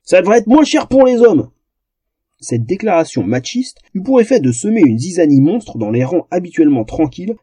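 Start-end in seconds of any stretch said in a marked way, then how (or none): 0.0-1.5 bawling. Acted. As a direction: rant like an outraged dwarf. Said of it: "brailla l'un des nains".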